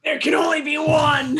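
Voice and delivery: booming movie-trailer shout; dramatic shout. Pretend. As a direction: bellow it like an epic hero.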